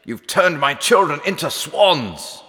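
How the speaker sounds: high, outraged accusation; king crying out in fury; angry king. A narrator's reading. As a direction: rage at the wicked queen. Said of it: "red-faced with anger at the queen".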